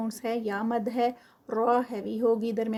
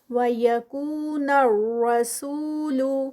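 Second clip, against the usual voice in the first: slow chanted tajweed recitation, vowels drawn out; Quranic recitation; slower than usual. Shown as a demonstration. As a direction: recite it slowly and melodically, stretching the long vowels. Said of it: "کو پہ ٹو counts". (آہستہ تجوید کے ساتھ تلاوت)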